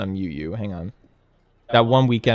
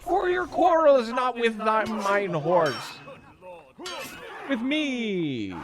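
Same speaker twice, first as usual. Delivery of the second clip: high, hammed-up old-English knight; medieval knight voice; slower than usual. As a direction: shout like an outraged knight. Voices game knight, mock archaic.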